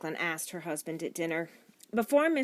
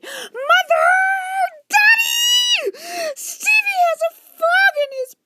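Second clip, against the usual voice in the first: put-on very high girl's screaming; loud scream; 14 dB louder than usual. A narrator's reading. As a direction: scream for your parents in alarm. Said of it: "interrupted by a loud scream".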